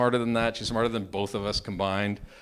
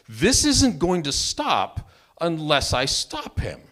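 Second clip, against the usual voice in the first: slow, loud, raised-pitch declaration; emphatic resolve; 7 semitones higher, 7 dB louder, slower than usual. Retold or imitated. Storyteller telling himself he must fight back, acted emphatic resolve. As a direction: spell it out slowly and forcefully.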